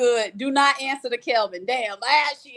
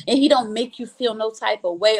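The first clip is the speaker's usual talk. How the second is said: normal talking voice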